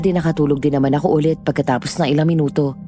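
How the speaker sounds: ordinary talk, nothing marked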